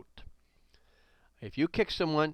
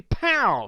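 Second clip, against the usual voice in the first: shouted impact sound; 9 semitones higher, 6 dB louder than usual. Retold or imitated. Retold kick impact sound.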